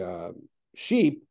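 ordinary delivery

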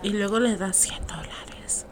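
whispering. Acted. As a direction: slip the punchline in a whisper. Whispered joke punchline.

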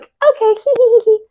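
high airy giggling "okay"; ditzy giggle. Retold or imitated. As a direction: giggle like a brainless doll. Barbies giggling at Ken.

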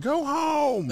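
put-on high, loud bratty kid's yell; imitated screamed order; screaming. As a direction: shriek it like a mean little kid. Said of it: "just screaming".

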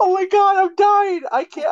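high, helpless laughing fit; hysterical laugh. Real, not acted. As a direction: laugh until you can't speak. Laughing fit over own mistake.

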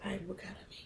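a quick unvoiced whisper to her cohost. Real, not acted. Cohost whispers an aside to her friend, voiceless whisper. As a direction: lean in and breathe the question, no voice.

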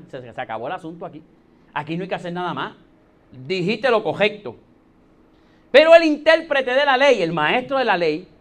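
usual speaking voice

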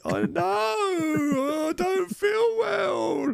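very high, slow, wailing mock cry; mock sobbing. Drunk husband wailing back at his wife, very high mock sobbing. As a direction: wail it like a bawling toddler. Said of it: "And I'm like".

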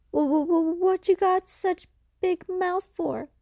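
frightened stammer. Acted. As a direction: stutter in fear at the creature. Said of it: "The boy is so frightened".

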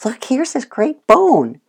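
high, slow, tempting voice; enticing offer. Shown as a demonstration. Owner tempting the dog to trade the shoe for a bone. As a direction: lure the dog with a bright offer. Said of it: "I would recommend".

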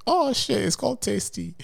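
very high-pitched realisation; surprised discovery. Real, not acted. Host finds the meals are called Tasty, surprised. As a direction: squeal it softly as it dawns on you. Discovers the brand's real name mid-search.

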